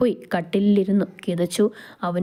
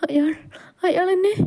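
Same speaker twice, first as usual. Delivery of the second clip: high-pitched, fearful plea; frightened wife; 11 semitones higher than usual. Acted. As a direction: voice a scared woman clinging to her husband. Frightened wife voiced in story. (പേടിച്ചരണ്ട പെണ്ണിന്റെ ഉയർന്ന സ്വരം)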